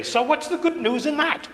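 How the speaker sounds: high-pitched put-on priest's question; Father Derek Sharples. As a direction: impersonate an English priest's pet question. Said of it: "as Father Derek Sharples used to say".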